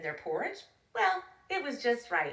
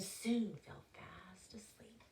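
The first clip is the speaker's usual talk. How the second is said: hushed bedtime whisper; 11 dB quieter, slower than usual. A narrator's reading. Narrator whispering to listeners as Goldilocks sleeps. Falling asleep in story.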